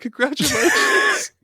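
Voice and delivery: high stretched-out congratulations; mock anime cheer. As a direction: sing it out slowly like an anime cast.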